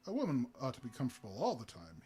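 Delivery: ordinary delivery, nothing marked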